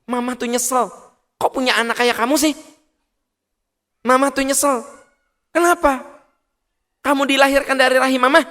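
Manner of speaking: high, loud, fast scolding mother; angry mother. Retold or imitated. Mother berating her child in anger, high shrill scolding. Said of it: "ibu saya kalau marah gini".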